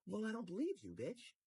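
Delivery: high sneering mock voice; mocking the streamer. Imitating the streamer going at the girl, high sneer. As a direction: sneer it up high like a nasty troll.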